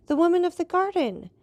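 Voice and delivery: high and loud, voice straining with dread; terrified. Acted. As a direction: blurt out the memory in horror. Steward terrified.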